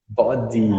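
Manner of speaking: Body with a clear t, "bodi"; British body. Shown as a demonstration. Teacher showing the student the British Body. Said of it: "British English, eles falam body".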